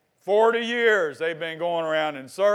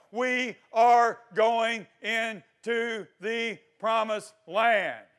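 very slow, higher, word-by-word proclamation; emphatic declaration; 5 semitones higher, 4 dB louder, much slower than usual. Acted. Preacher rallying the church toward the promised land, emphatic declaration. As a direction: declare it slowly, each word set apart.

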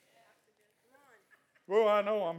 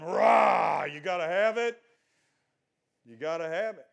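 loud emphatic preaching; 8 dB louder than usual. Real, not acted.

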